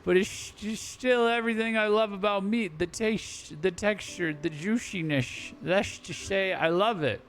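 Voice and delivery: lightly voiced old pirate relishing meat; old pirate savoring food. Voices retired pirate eating.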